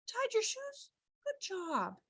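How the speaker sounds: high, soft, token sweetness; inauthentic praise. Shown as a demonstration. Teacher giving a child token praise, small high voice. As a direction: coo it softly without real excitement. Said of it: "And not like".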